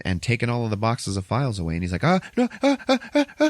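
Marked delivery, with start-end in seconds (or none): 2.0-3.4 very high panicked yelps; hammed-up stammering panic; freaking out. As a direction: imitate a childlike meltdown. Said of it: "he starts freaking out".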